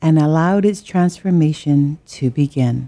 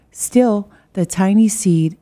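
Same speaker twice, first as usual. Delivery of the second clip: usual speaking voice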